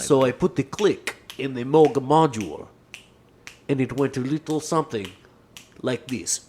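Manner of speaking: put-on European accent, phrase by phrase. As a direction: imitate the accented producer recounting his discovery. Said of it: "[name] calls it".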